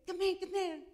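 high, loud beckoning call; calling her over. Retold or imitated. Doctor calls her forward.

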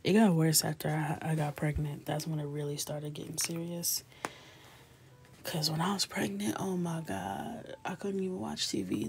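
normal talking voice

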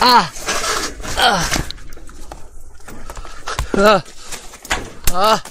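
real startled "ah!"; startled yelps. Real, not acted. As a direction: yelp in sudden fright. Startled while exploring a scary place.

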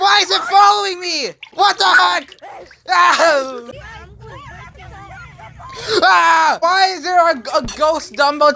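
panicking gamer. Real, not acted. Chased by haunted dumbbell.

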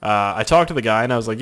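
usual speaking voice

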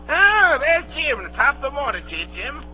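effusive, exclaimed welcome; hearty greeting. Acted. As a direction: hail the lad like an old friend. Silver greets Jim.

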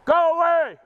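high loud yell at a bear; scaring off a bear. Real, not acted. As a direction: bellow to chase it away. Yelling at bear.

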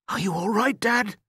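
put-on high-pitched child's question; worried boy. A narrator's reading. Son finding his father hurt, worried boy's voice. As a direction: ask as an anxious young son.

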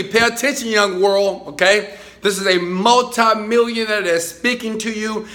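usual speaking voice

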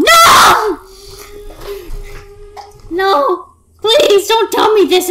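loud, pleading no; desperate shout. Real, not acted. Player yelling at the sinking ship, loud and desperate. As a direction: cry out against the crash.

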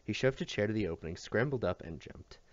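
ordinary delivery, nothing marked

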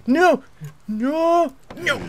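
high, loud panicked cries; frantic yelps of no. Real, not acted. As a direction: shriek it in sudden fright. Monster rushes at player.

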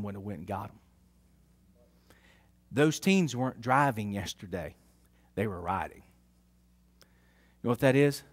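hushed heartfelt preaching; soft preaching. Acted. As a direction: draw them in gently.

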